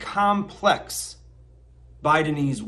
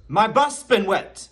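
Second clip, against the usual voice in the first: "bus" pitched up and punched in "my bus been wet"; stress on BUS; 5 semitones higher than usual. Shown as a demonstration. Teacher correcting the class, stress on "bus". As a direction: lift and hit the word "bus".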